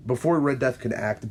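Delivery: ordinary delivery, nothing marked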